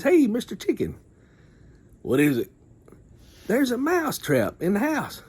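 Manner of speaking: high squeaky mouse voice, pleading; little mouse. Retold or imitated. Mouse warning the chicken, high squeaky voice.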